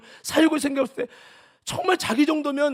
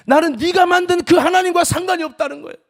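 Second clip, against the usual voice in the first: fervent preaching; 9 semitones higher, 12 dB louder than usual. Acted. Preaching climax.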